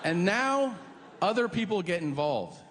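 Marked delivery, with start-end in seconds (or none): none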